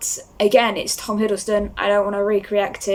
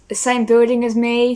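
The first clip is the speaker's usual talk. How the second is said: ordinary delivery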